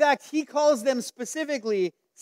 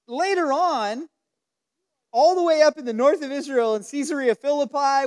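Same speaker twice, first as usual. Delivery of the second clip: ordinary talk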